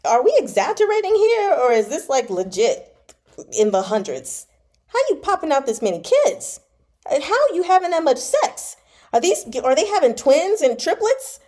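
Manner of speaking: real shock at the numbers; loud stunned disbelief; incredulous outburst. Real, not acted. Incredulous reaction to hundreds of sons.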